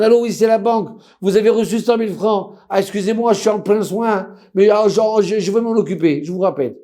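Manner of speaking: high, lively retold phone call; animated phone retelling. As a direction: rattle it off like a busy dentist brushing off the bank.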